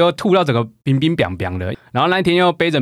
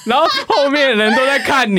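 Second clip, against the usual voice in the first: laughing shout; 10 semitones higher than usual. Real, not acted. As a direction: burst out laughing while telling it. Laughing retelling of stumbling.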